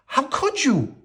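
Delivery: high, shocked fussy servant; dismayed little clock. A narrator's reading. Cogsworth shocked at the beast letting her go. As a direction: squeak out the disbelief. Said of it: "cried Cogsworth".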